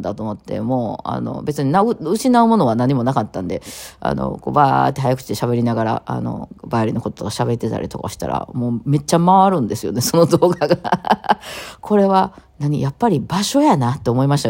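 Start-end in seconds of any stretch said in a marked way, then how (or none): none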